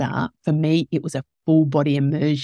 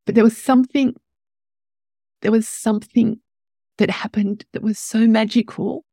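higher voice, close to tears; moved, fighting the tears; tearful; 5 semitones higher than usual. Real, not acted. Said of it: "I'm gonna cry now".

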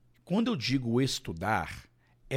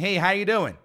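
casual greeting; 6 semitones higher, 5 dB louder than usual. Retold or imitated. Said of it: "alguém chega pra você e pega e fala assim".

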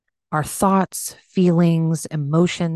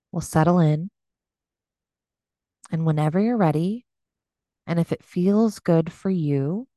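unhurried meditation voice with long pauses; gently pace them through breath and body, leaving space; guided meditation. Acted. Leads a body scan meditation.

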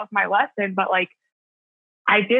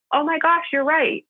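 sudden realization; 7 semitones higher than usual. Retold or imitated. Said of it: "he was like".

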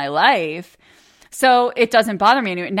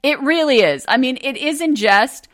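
higher and louder, bright mock enthusiasm; owning it playfully to husband, higher and louder; playful agreement; 6 semitones higher, 4 dB louder than usual. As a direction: cheerfully own it with a grin. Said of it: "And I'm like".

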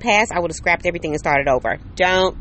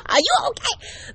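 mother-in-law. Retold or imitated. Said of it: "my mother-in-law was like".

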